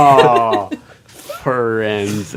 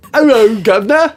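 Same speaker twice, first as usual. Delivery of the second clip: high, loud bad British accent; 14 semitones higher, 11 dB louder than usual. A defendant's new British personality greets the court in a bad British accent. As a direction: ham up a cheap British accent. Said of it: "that's a bad British accent".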